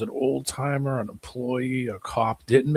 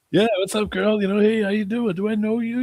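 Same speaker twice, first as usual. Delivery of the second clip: higher mock pickup-line voice; guys hitting on her; 10 semitones higher, 4 dB louder, faster than usual. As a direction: ham up a guy chatting her up. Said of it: "people probably walked up to her".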